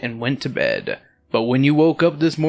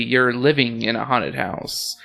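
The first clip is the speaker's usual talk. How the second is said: ordinary talk, nothing marked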